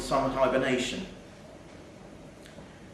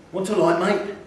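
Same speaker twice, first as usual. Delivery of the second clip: higher, louder friendly offer; a bright, eager greeting to a customer; cheery café owner; 5 semitones higher, 4 dB louder than usual. Acted. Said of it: "said a cheery voice".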